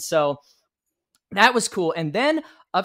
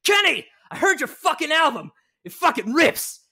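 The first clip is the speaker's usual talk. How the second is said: loud, much higher yell from across the room; Jeff yelling; 11 semitones higher, 4 dB louder than usual. Retold or imitated. Jeff shouts praise at Kenny across the green room, loud. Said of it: "Jeff was yelling at me".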